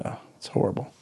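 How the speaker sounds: breathy near-whisper, imitating his wife; hushed retold dread. Wife breaking bad news about an email, hushed. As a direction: soften to a hushed, dismayed hush. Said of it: "And she goes".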